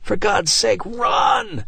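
high, rising scream to run; desperate scream. A narrator's reading. Father screaming at his family to run. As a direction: scream them into running. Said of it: "Martin screamed at them".

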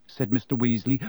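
ordinary delivery, nothing marked

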